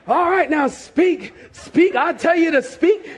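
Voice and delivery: high, insistent mock pastor; hammed-up forceful command. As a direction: imitate a preacher forcing the words out of someone.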